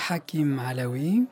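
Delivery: ordinary talk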